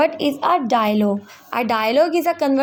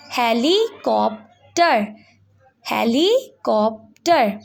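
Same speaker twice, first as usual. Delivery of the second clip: helicopter said slowly, he-li-cop-ter, each syllable clear; much slower than usual. Shown as a demonstration. Teacher sounding out helicopter for students, slowly. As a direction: stretch helicopter out so every syllable counts. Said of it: "learn the correct pronunciation".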